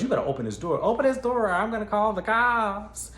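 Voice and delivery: loud, high threatening yell; angry threat. Retold or imitated. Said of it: "So he goes".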